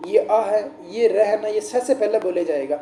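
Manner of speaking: usual speaking voice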